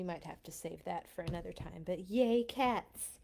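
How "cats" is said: usual speaking voice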